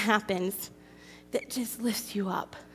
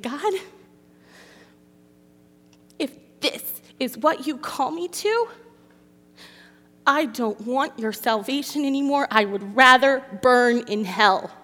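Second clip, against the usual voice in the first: louder, high anguished outcry; retold scream at God; 4 semitones higher, 6 dB louder than usual. Woman retelling how she screamed at God, anguished. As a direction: hurl it at God, half-acted. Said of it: "But I screamed at him".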